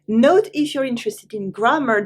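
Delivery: usual speaking voice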